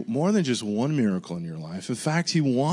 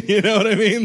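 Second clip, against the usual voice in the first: laughing; 8 semitones higher, 6 dB louder than usual. Real, not acted. Still laughing at himself.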